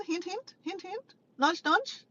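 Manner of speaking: sing-song hinting; playful coy hinting; teasing hint. Acted. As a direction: nudge them with a wink.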